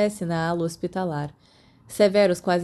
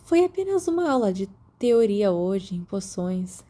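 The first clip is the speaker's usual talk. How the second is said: ordinary delivery, nothing marked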